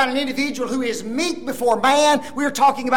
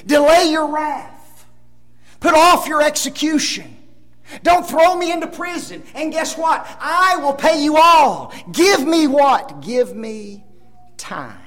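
pleading; 6 semitones higher, 6 dB louder than usual. Acted. Preacher voices debtor's plea.

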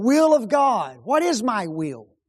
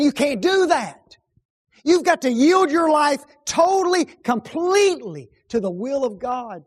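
mock preacher; 6 semitones higher, 5 dB louder than usual. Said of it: "a preacher standing in the pulpit saying".

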